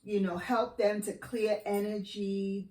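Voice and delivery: ordinary talk, nothing marked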